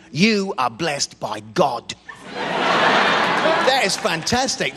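very slow, grand mock reverence; mock majestic. Praising America's anthem in a slow mock-grand intone. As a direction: intone it like a hymn.

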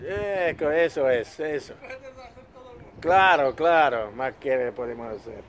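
playful mock Spanish; Spanish accent. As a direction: banter in fake Spanish. (con accento spagnolo scherzoso)

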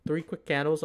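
usual speaking voice

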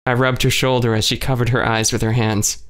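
ordinary delivery, nothing marked

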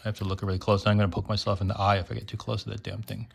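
ordinary talk, nothing marked

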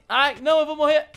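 high, loud panicked wail. Real, not acted. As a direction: cry out as the boss hammers you. Taking big knockback in fight.

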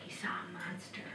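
whispered storyteller's reveal; near-silent, suspenseful whisper; hushed reveal. A narrator's reading. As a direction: drop to a whisper for the scare. Reveal of the monster.

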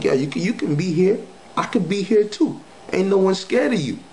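defiant taunt. Retold or imitated. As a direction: act unafraid, pitch it up and needle her.

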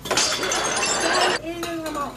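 a loud, put-on voice yelling why; loud protest. Toy yelling at the food thief, loud voice. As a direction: shout at the one stealing the food.

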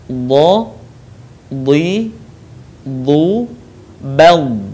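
drilled Arabic letter syllables; letter drills. Shown as a demonstration. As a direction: say each syllable slowly and cleanly.

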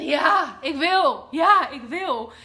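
loud, slow pleading yes; hammed-up ecstatic shout; ecstatic cry. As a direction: cry it out on your knees. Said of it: "in extase ... zegt".

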